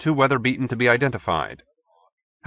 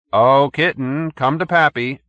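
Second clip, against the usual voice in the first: slow, coaxing call; old man coaxing his whirlwind, slow and loud; calling fondly; 5 semitones higher, 5 dB louder, slower than usual. A narrator's reading. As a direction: call her like a pet.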